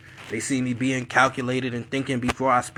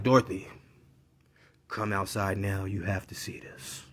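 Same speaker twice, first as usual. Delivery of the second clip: low, hushed urging; quietly serious; 7 semitones lower, 8 dB quieter than usual. Retold or imitated. Dad goes up to mom, low and serious. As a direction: drop low, imitating a father's grave insistence. Father urges mother, retold quietly.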